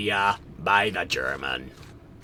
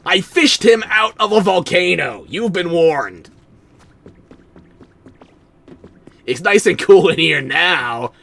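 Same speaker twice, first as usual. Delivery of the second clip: high, loud, slow proclaiming; loud read dialogue; 8 semitones higher, 7 dB louder than usual. Acted. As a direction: boom the lines out. Reading game dialogue aloud.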